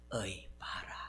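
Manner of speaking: breathy whispered "어이 봐라" in Gyeongsang dialect. Shown as a demonstration. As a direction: hush it like a shocked aside. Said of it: "경상도 버전으로 바꾸면". (경상도 사투리로 속삭이듯)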